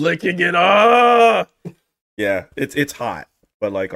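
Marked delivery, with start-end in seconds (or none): 0.5-1.5 sudden high yelp of pain. Real, not acted. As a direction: cry out as the heat hits. Licking burning hot lips.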